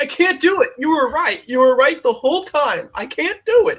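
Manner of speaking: high, whiny hammed-up wail of defeat; mock breakdown. Artist pretending to fail the customer's challenge, mock sobbing wail. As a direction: fake a tearful collapse. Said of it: "Just, like, break down".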